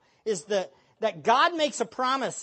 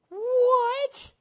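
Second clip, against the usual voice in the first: outraged what; 4 dB louder than usual. Real, not acted. Preacher reacting to weak claim.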